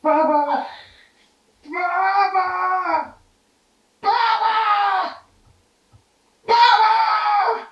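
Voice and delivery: very high wailing puppet calling out; goofy crying sock puppet. Pretend. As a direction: wail Baba like a crying toddler puppet.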